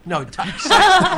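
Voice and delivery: excited said slowly, high and loud; stress on excited. Shown as a demonstration. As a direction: stretch out excited so it is clear. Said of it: "Am I excited?".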